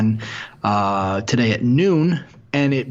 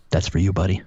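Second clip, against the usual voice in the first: sultry narrator; 5 semitones lower than usual. Pretend. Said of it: "my low, sultry voice".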